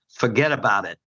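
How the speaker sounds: put-on light Brooklyn accent, tongue in cheek. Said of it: "I'm gonna do my best Brooklyn accent here".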